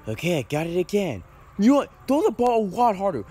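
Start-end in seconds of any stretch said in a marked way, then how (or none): 1.6-3.2 louder, higher, fed-up demand; demanding, fed up, that his friend throw harder. Acted. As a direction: push him hard to throw harder.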